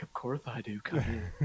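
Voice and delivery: low, soft sultry granny; sexy grandma voice. Retold or imitated. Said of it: "That's a good sexy grandma voice".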